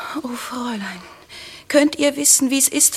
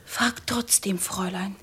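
whispered urging; 5 semitones lower, 7 dB quieter than usual. Acted. Girl urges lady to ask.

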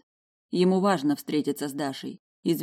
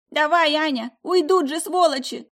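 high, loud shouting granny; old woman yelling; 11 semitones higher, 5 dB louder than usual. A narrator's reading. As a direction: yell like a feisty old lady.

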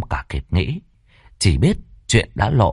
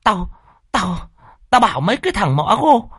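high-pitched angry tirade; mother's furious, shrieky scolding; hissed fury; 12 semitones higher than usual. Acted. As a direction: demand and threaten in a strained high voice. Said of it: "bà lại rít lên".

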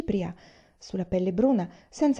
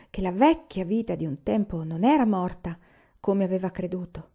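plain reading voice

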